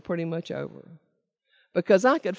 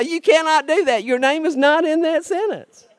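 mock scolding; 9 semitones higher, 5 dB louder than usual. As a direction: lay down the rule like a stern friend. Friend scolding her loophole.

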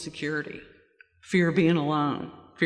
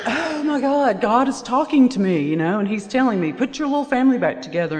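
high, excited gasp of revelation; suddenly thrilled, voice jumping up; high excited rush, as if God just spoke; excited realization; 6 semitones higher, 4 dB louder than usual. Retold or imitated. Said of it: "And I'm like".

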